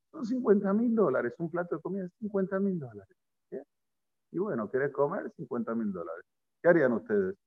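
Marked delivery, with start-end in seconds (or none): 0.1-3.6 price slipped in quickly under the breath; soft, hurried mock-secret mumble; hushed, sly aside. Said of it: "lo dice despacito para que el otro no lo sienta". 4.3-6.2 very soft, casual mock offer; barely audible, dropping away; hushed, sly offer. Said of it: "lo dice bajito".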